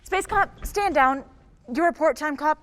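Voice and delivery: loud, stern commanding order. Acted. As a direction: bark the order to stand down. Chief silences squabbling cops.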